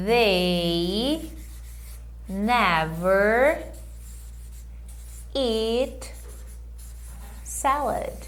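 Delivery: "they never eat salad" very slow, word by word; slow model sentence. Shown as a demonstration. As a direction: spell it out slowly for learners. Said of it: "Vê aí se você consegue". (frase modelo lenta, palavra por palavra)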